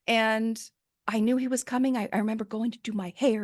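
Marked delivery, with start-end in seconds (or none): none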